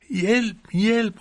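high, loud shout from a falling character; cry for help. A narrator's reading. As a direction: call out high and loud in alarm. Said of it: "råbte Plys". (højt, lyst råb om hjælp)

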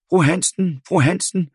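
high, loud calls across the yard; shouting. A narrator's reading. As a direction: call out to the mother. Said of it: "fru Jensen råbe".

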